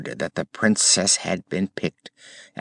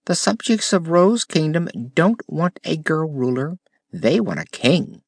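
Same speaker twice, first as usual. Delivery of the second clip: higher, earnest gardener voice; lightly voiced gardener; gardener explaining; 9 semitones higher than usual. A narrator's reading. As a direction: explain it like a proud old gardener. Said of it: "explained the gardener".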